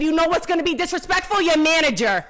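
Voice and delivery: put-on loud, high angry yell at the old clerk; retold shouting match. Telling off the rude clerk at the counter, loud angry shout. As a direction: threaten her with the manager, full volume. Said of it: "I said".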